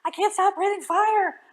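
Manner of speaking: high, loud panicky dad; father panicking as fire pours out; panicked. Acted. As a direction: blurt it in panic. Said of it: "Crispin's father panicked".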